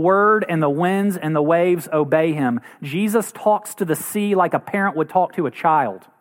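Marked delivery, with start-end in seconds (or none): none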